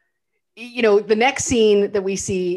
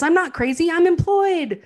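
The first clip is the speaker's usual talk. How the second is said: high, insistent, louder retort; mock indignant protest; 8 semitones higher, 4 dB louder than usual. [name] tells his agent he's employed, in high mock protest. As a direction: imitate an actor triumphantly arguing back.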